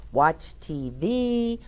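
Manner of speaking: usual speaking voice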